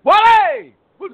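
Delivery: big, high-pitched shout of a name; calling out. Real, not acted. Host greets his guest on air, with a loud high shout.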